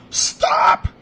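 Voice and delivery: sharp warning shout; urgent shouted stop; retold yell. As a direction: yell stop to freeze him. Yelling at friend near snake.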